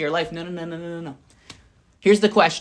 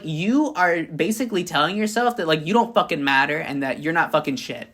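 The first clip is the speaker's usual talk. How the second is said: ordinary talk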